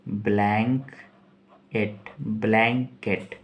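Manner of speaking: Blanket stretched slowly; slow spelled out. Shown as a demonstration. Teacher to students spelling word, slow careful pronunciation. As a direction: draw out blanket syllable by syllable. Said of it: "B L A N यानी blank".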